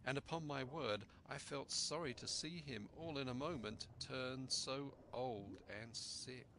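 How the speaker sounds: ordinary delivery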